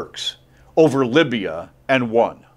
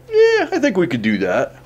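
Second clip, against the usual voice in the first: eager Russia; 7 semitones higher than usual. Retold or imitated. Russians agreeing to annex.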